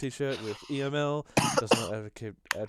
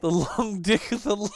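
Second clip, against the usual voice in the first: incredulous; 9 semitones higher, 9 dB louder than usual. Real, not acted. Incredulous repeat of joke.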